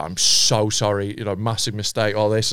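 grovel softly down low; grovelling apology. Retold or imitated. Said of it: "groveling apologies".

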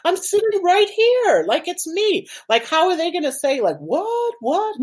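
high-pitched baffled inner protest; retold outrage, voice shooting way up; indignant disbelief. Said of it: "and I was like".

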